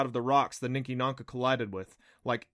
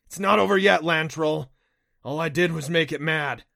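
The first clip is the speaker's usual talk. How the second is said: raised, higher voice; urgent warning; 4 semitones higher, 9 dB louder than usual. A narrator's reading. Rider warning Adam the beast is mad, urgent and loud. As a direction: call it out urgently over the chase. Warning mid-chase that danger remains.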